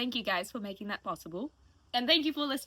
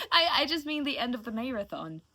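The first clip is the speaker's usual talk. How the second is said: laughing; 4 semitones higher, 6 dB louder than usual. Real, not acted. Laughs at own misstatement.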